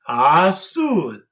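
high sneezed azul; sneeze azul. Shown as a demonstration. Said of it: "blue dog sneezes".

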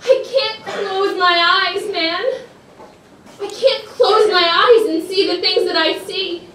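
desperate, high and loud, losing control; freaking out. Acted. Trapped inside her own head, freaking out. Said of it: "you went into this big emotional thing".

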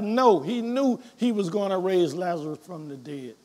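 normal talking voice

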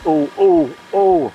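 normal talking voice

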